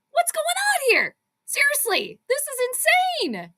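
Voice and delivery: high-pitched, stretched-out astonishment; excited disbelief. Real, not acted. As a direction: draw it out high, as if stunned by the game. Fan reacting to wild game.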